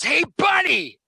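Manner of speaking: high, loud heckling shout, slowed on the second line; heckling fan yells at the singer, loud and high; lady yelling. Retold or imitated. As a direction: shout a mocking heckle from the crowd. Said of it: "some lady yells at me".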